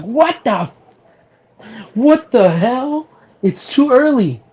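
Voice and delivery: high-pitched, drawn-out disbelief; mock shock. Viewer reacting to the killer's early face reveal, shocked. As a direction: act out a stunned viewer, pitch shooting up.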